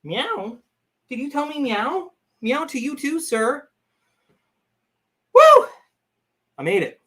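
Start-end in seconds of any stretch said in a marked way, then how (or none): 0.0-3.7 high, sing-song voice for the cat; high cat talk. Real, not acted. Talking back to her meowing cat, high playful coo. 5.3-5.7 very high, very loud whoop of triumph; excited whoop. Real, not acted. Cheering that she made it onto the stream, loud high whoop.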